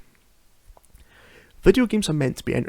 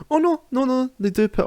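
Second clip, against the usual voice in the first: sudden self-correction; 12 semitones higher than usual. Real, not acted. Correcting his own mistake.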